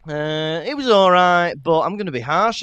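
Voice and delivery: ordinary talk, nothing marked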